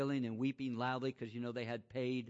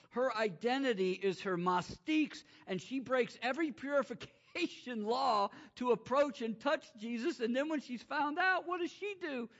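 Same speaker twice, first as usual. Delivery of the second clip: rising, louder preacher's build; impassioned preaching, higher and louder; preaching at full force; 11 semitones higher, 5 dB louder than usual. Acted. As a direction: climb in pitch and volume, preaching.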